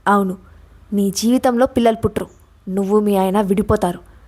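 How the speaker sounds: ordinary delivery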